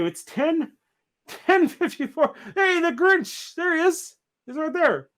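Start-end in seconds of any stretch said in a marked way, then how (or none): 2.6-5.1 high, delighted hey to a surprise guest; thrilled to spot the Grinch; excited greeting. Real, not acted. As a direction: greet him like a big surprise. Santa spots the Grinch guest.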